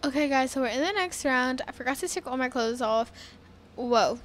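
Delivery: ordinary talk, nothing marked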